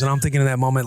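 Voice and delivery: ordinary delivery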